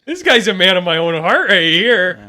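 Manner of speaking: hearty mock Minnesota agreement; Minnesota accent. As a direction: play a Minnesotan backing up a friend. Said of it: "we have a similar voice".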